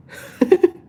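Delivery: loud, high-pitched giggle; real, loud laugh. Real, not acted. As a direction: burst into a short high cackle. Joking with chat about timeout.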